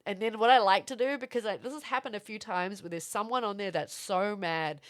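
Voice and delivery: normal talking voice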